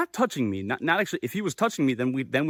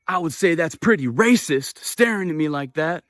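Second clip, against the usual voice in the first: imitated angry accusation; 4 semitones higher, 4 dB louder than usual. Acted aggressive man's accusation.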